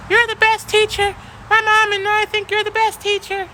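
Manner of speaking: high, gushing imitation of a girl; student praising her teacher, mocked sweet. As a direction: chirp it like an adoring kid. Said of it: "she just said".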